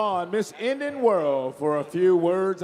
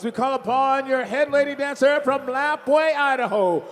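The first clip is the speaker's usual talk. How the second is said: ordinary talk, nothing marked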